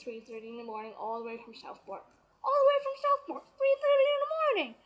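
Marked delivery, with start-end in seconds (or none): 2.5-4.7 very high, loud disbelief; amazed echo. Retold or imitated. Reporter hearing how far the fan came, amazed shriek. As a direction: shriek it back in astonishment.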